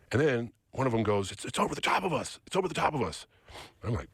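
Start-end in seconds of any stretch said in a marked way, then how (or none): 1.3-3.3 imitating a panicked guy, pitched up; frightened. Scared guy in car about the craft, panicked higher voice. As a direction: retell his panicked cry, higher. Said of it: "they're freaking out".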